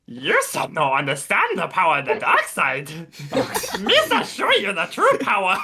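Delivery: high, goofy dark lord voice; menacing Sith lines in a silly Gungan voice; Jar Jar Sith voice. Acted. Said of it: "you can just imagine him sitting in a chair".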